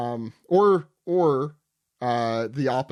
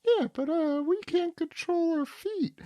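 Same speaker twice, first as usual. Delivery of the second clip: put-on high, whiny lost children; high kid voices. Recapping manga to friends, voicing scared children high. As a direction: play the confused kids, high.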